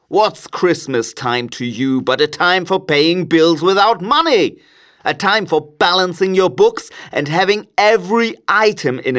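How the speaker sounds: high-pitched, indignant miser's rant; cantankerous miser working himself up; irate Scrooge. A narrator's reading. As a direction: rant with mounting outrage, peaking high and loud. Scrooge rants against Christmas.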